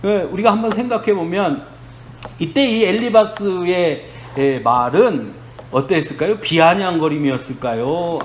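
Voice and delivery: ordinary talk, nothing marked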